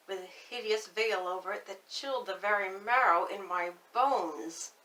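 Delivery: usual speaking voice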